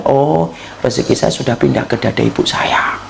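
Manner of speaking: put-on soft whispered inner voice of a baby. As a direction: hush it like a secret thought. Baby's inner thought. (bisikan lembut bayi)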